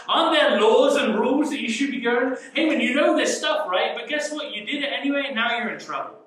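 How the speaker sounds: high, emphatic telling-off; mock scolding. Preacher telling Haman he knew the rules, mock scolding.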